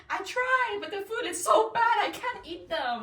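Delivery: high, loud whiny excuse; whiny complaint. Retold or imitated. Telling friend about the cashier, whiny high excuse. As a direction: whine it like a guilty kid. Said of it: "I was like".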